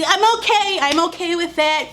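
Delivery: high, forced cheerfulness; mock compliance. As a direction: fake being fine. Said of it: "I'm supposed to just be".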